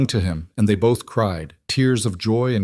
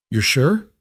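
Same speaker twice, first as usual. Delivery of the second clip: higher, breathless question; stunned disbelief; 6 semitones higher than usual. A narrator's reading. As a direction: barely get the words out. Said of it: "he finally managed".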